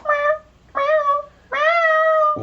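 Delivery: mock cat meows, pitched very high; imitated meowing. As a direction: meow in a high put-on voice. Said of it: "those girls were just all".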